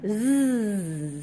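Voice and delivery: long buzzing "Z"; isolated z sound. Shown as a demonstration. Teacher recalling the letter between two vowels, buzzed sound. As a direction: hold the consonant "Z" as a sustained buzz. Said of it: "celui qui est coincé entre".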